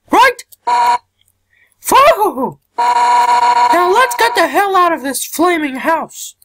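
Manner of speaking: high-pitched tough fighter voice; high character voice. Acted. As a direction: voice a brash friendly fighter up high.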